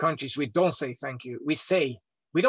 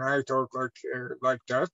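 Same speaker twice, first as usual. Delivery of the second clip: very deep voice; 4 semitones lower, 3 dB quieter than usual. Shown as a demonstration. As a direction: drop into a low, deep register. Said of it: "sometimes I talk very deep".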